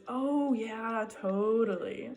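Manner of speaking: drawn-out, sarcastic "totally"; mock enthusiastic sarcastic reply; exaggerated sarcasm. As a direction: stretch it out, dripping with sarcasm. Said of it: "a very exaggerated response".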